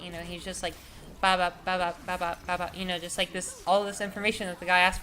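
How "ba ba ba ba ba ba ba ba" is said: mock robotic listing; robot rattle-off. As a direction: rattle it off like a machine. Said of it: "like a robot".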